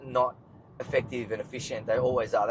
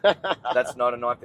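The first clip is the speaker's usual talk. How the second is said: slow, louder Australian line, knife drawn out; thick Australian accent; 6 dB louder, much slower than usual. Shown as a demonstration. Australian accent, quoting the knife line when his friend keeps urging him. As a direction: play up the Australian accent like a movie bushman. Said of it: "With a real Australian accent".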